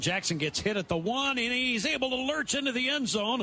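rising excited touchdown call; play-by-play. Acted. Live football play-by-play call.

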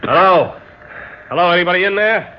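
loud, high call to someone unseen; shouting into an empty building. Acted. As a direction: hail the house loudly, a little fast. Calling at a strange door.

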